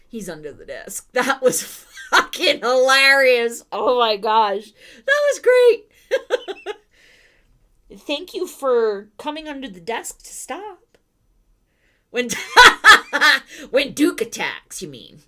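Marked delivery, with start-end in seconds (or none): 1.1-6.8 loud slow laughing words. Real, not acted. Laughing hard at dog. 13.0-14.8 loud burst of laughter; laughing. Real, not acted.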